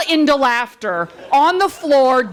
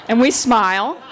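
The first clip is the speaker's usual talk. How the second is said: ordinary talk, nothing marked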